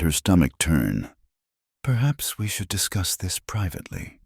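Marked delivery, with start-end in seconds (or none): 1.8-4.2 soft, hushed and careful; quiet. A narrator's reading. Abigail to Thomas, quietly asking for privacy. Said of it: "Abigail said quietly".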